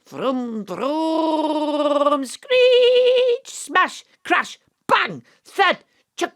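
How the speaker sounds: vocal car crash. Pretend. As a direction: play the crash with your voice. Host voices a car crash.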